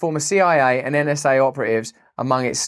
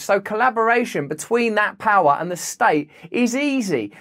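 ordinary talk

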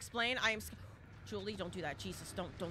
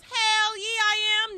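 excited; 10 semitones higher, 11 dB louder, much slower than usual. Real, not acted. Excited about upcoming game.

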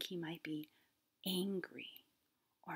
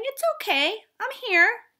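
higher, louder soothing parent voice; comforting parent; 11 semitones higher, 7 dB louder than usual. Retold or imitated. Parent hugging a hurt child, high soothing reassurance.

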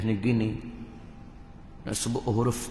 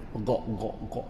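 gulping sound; 4 semitones lower, 9 dB quieter, slower than usual. Retold or imitated. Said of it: "Tak boleh minum".

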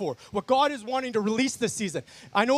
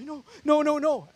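pleading no; 5 semitones higher than usual. Retold or imitated. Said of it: "I'm like".

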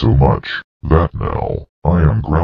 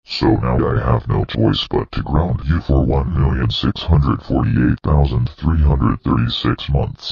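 usual speaking voice

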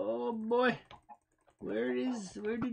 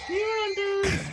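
high chicken call; 9 semitones higher than usual. Pretend. Calling the hunters as chicken.

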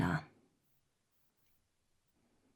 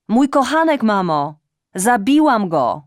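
loud, high, cracking admission; anguished confession; 4 semitones higher, 9 dB louder than usual. Acted. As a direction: blurt it out over the line. Daughter confesses loudly. (głośne, rozpaczliwe wyznanie)

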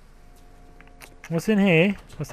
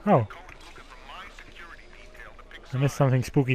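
ordinary talk

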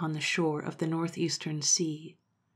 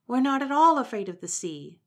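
higher and louder insistent monkey voice; insisting monkey; 7 semitones higher, 6 dB louder than usual. Acted. Monkey insisting to Momotaro, higher and louder. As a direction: protest your courage.

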